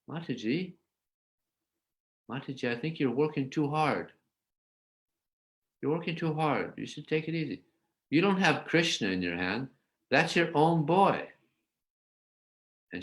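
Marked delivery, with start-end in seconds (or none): 0.1-11.3 soothing mother. Retold or imitated. Yasoda soothing the tired gopi.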